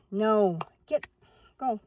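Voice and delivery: loud sharp no to the dog; startled loud scold at the dog. Real, not acted. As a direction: bark a single firm no. Dog jumps off bed.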